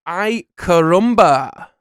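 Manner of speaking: loud, high, drawn-out exclamation; cartoon catchphrase. Host reads a quote for the quiz, loud cartoon exclamation. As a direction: belt out the catchphrase, stretching it.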